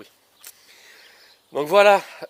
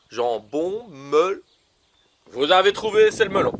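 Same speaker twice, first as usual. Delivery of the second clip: ordinary talk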